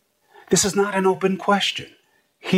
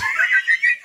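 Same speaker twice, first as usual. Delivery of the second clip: short, much higher laugh put on as a demo; mock laugh. Shown as a demonstration. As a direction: show the laughter nobody did. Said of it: "You see people behind him like this?".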